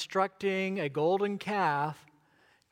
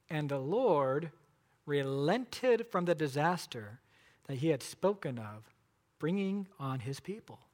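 scripture reading; 4 semitones lower, 3 dB quieter than usual. Acted. Reading verse fourteen.

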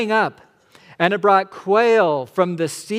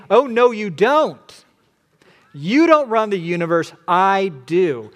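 firm divine rebuke; 4 semitones higher, 7 dB louder than usual. Retold or imitated. God answering the complainer, firm and commanding. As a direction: put the complainer firmly in place. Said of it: "And God is saying".

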